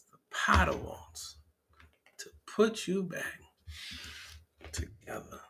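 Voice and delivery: whispered, fading to nothing; a sung line trailing off into a whisper. Acted. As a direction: let it die away in a whisper.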